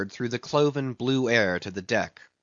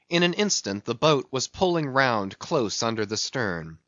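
usual speaking voice

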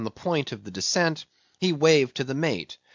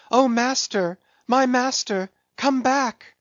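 high, urgent pleading call; cried plea; 11 semitones higher, 4 dB louder than usual. A narrator's reading. Voice from the cabin window begs master back, cried plea. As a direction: beg in a very high, distressed voice. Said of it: "cried a voice from the low cabin window".

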